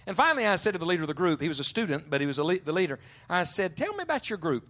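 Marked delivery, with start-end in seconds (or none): 3.8-4.7 much higher, eager opening; eager question. Retold or imitated. Preacher eagerly quizzing the student leader, high and keen. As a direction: lift it up with eager curiosity.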